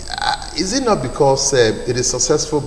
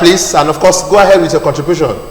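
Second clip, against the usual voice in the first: loud, quick, raised voice to the caller; to someone hard of hearing; 4 semitones higher, 8 dB louder than usual. Real, not acted. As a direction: speak up so the caller hears. Caller on a bad phone line.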